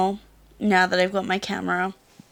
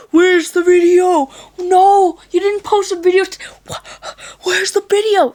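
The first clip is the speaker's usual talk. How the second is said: shrill pushy fan, much higher, louder; high demanding fan voice; 11 semitones higher, 9 dB louder than usual. Retold or imitated. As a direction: squeal it like an impatient fan. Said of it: "people are like".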